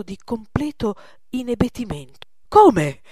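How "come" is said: very loud, pained outburst; anguished cry. A narrator's reading. Old master asked to show his canvas, anguished cry. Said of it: "gridò infine con angoscia".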